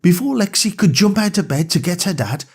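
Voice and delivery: ordinary delivery, nothing marked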